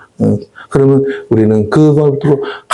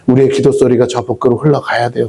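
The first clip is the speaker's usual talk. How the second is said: ordinary delivery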